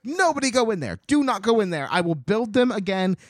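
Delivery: high, loud urgent warning; urgent shouting. Retold or imitated. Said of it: "She's like".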